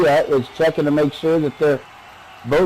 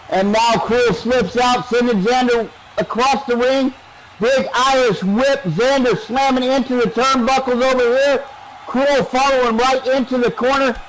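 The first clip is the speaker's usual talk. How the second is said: steady, raised wrestling commentary; live play-by-play; 7 semitones higher than usual. Real, not acted. Announcer calling a whip into the corner, animated play-by-play. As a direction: keep the action driving, pitched up.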